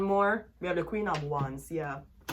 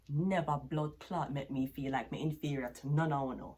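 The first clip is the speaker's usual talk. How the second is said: usual speaking voice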